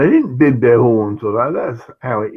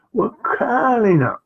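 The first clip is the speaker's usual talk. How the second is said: ordinary delivery, nothing marked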